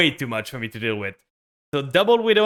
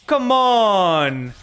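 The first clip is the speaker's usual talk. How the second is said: high, drawn-out protest; exasperated outcry; 4 semitones higher, 4 dB louder than usual. Real, not acted. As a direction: cry out in frustration, pitch sliding down. Losing the game in frustration.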